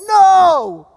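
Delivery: a loud high shout of correction; ringing classroom shout. Retold or imitated. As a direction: yell it to shake the class. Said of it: "And he's like".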